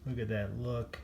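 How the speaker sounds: ordinary delivery, nothing marked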